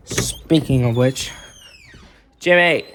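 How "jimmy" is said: real call to someone nearby, higher and louder; raised-voice summons. Real, not acted. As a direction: call the name out, pitched up. Calls out to Jimmy nearby.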